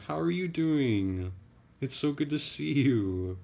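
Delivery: high, loud and drawn-out; effusive welcome. Real, not acted. Streamer welcoming a viewer to the chat, excited and effusive. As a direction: gush in a slow, high singsong.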